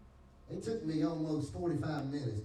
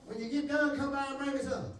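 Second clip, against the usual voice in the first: eager plea; 11 semitones higher, 3 dB louder than usual. Retold or imitated. Begging dealer to bring weed.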